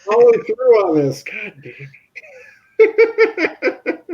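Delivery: laughing, voice cracking high; mock outrage through laughter; laughing mock villain. Friend imitating the villain's dismay, laughing through it.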